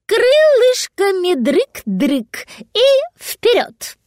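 high, drawn-out magpie voice; magpie demonstrating. A narrator's reading. Magpie showing the dragon how to flap, high, drawn-out. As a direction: stretch the words out high and playfully.

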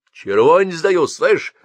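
loud, high, insistent bargaining; pushy offer. Acted. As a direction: shove the money at him. Thug raises the offer.

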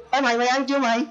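rude call-out. Acted. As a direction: call out casually and rudely. Phật tử gọi bạn. (giọng gọi bạn cao, suồng sã)

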